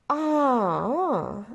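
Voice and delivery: drawn-out, high coy realisation. A narrator's reading. Minerva guessing where he was, coy. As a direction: play demure, with a pause. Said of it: "își lăsă ochii pudică".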